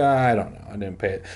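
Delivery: ordinary delivery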